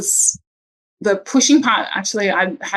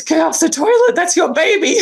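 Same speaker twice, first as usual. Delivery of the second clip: much higher, louder alarmed command; urgent shout; 10 semitones higher, 4 dB louder than usual. Retold or imitated. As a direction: shout it like the baby is coming now. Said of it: "And she just goes".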